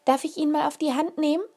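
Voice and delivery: put-on eager little girl. A narrator's reading. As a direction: beg to hold it, eager. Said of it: "Sie war mindestens genauso aufgeregt".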